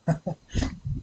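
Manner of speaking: sudden sharp hiccup; a real hiccup catching the breath. Real, not acted. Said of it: "got the hiccups".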